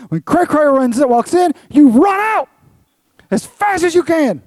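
high, very loud preacher's shout; urgent shouted warning. Real, not acted. Preacher warning the congregation to flee temptation, urgent shout. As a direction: shout it like an alarm, drawing out the key words.

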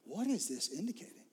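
soft whispered question to Jesus; praying under the breath; hushed prayer. Shown as a demonstration. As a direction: ask quietly, intimately. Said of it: "just say".

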